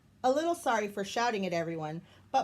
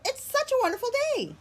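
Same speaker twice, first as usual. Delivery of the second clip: much higher and fast, cheery chatter; high, fast Maribel; 3 dB louder, faster than usual. Acted. Maribel cheering up Jim, high and quick. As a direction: rattle it off high and bubbly.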